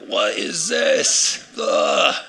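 slow, loud, disgusted mock shout; hammed-up yuck at a strange taste. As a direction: recoil from the pineapple pizza. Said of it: "You'd be like".

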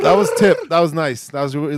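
ordinary talk

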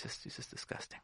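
barely voiced whisper; disgusted whisper. Wife's secret thought about her husband, whispered. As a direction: trail off into a private whisper.

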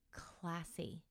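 tearful whispered word; choked-up whisper. Real, not acted. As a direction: breathe it out with no voice. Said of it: "the tears are already coming".

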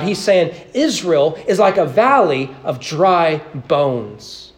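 ordinary talk, nothing marked

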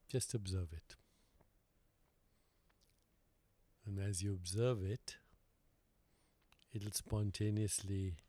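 calm, unhurried meditation guide with long pauses; guided meditation. Real, not acted. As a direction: lead them gently inward, leaving silence between phrases.

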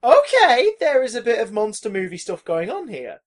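mock astonished double take; acted-out surprise. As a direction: start with a high gasp of realisation, then ease down. Acting out reaction to the ending.

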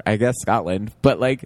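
ordinary talk

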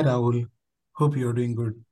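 ordinary delivery

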